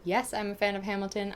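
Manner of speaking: ordinary talk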